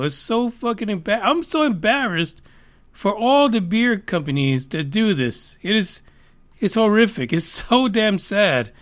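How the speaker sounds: ordinary talk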